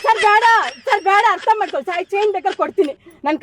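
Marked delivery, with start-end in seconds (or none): 0.1-1.2 desperate begging. Acted. Captive begs to be freed.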